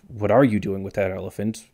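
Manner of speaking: accent lands on ARE, rising at the end; stress on ARE. Shown as a demonstration. Comparing six questions for viewers, stress on ARE. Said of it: "where you place the accent".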